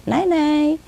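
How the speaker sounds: high sing-song nai-nai; parrot mimicking owner. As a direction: chant it high like a copied goodnight. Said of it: "Exactly like my voice".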